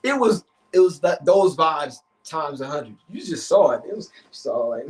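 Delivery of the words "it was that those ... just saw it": usual speaking voice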